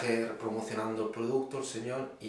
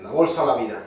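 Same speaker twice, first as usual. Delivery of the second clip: loud, abrupt hold-up order; robber's demand; 12 dB louder, faster than usual. Acted. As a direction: call it out like a robber threatening a couple. Ladrón amenaza a una pareja.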